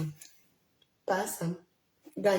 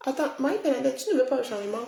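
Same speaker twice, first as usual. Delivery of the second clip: challenging; 6 semitones higher, faster than usual. Real, not acted. Streamer challenging a viewer who rejects change, raised voice. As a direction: push back at her, incredulous.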